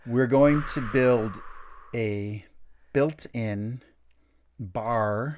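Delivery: "build a built-in" said slowly and deliberately; slow careful retake. Real, not acted. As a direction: carefully redo "a built-in" word by word. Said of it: "Let's try again".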